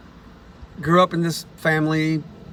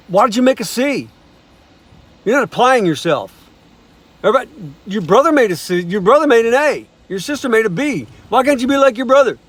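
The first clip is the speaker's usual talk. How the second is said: higher, louder mock scold; scolding parent; 6 semitones higher, 5 dB louder than usual. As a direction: nag like a disappointed parent.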